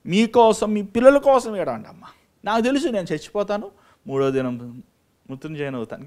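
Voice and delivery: lightly voiced Jesus, preached loud; voicing Jesus. Preacher voices Jesus addressing Jerusalem's daughters, fervently. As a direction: speak as Jesus to the weeping women. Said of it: "అని దేవుడు ఆ రోజే చెప్పాడు".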